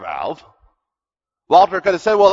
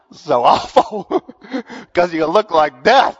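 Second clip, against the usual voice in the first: laughing; slower than usual. Real, not acted. Laughs retelling hospital visit.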